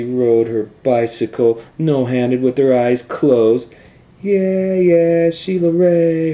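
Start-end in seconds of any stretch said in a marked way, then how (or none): 4.2-6.3 put-on high, loud cheering kids; friends cheering. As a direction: cheer her on like a crowd of friends. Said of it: "her friends yelled".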